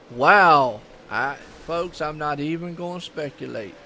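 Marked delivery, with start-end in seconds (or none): 0.1-0.8 a loud, floored "wow"; blurted-out amazement at the crash; stunned wow. Real, not acted. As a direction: let the shock burst out loud. Stunned by a wreck on track.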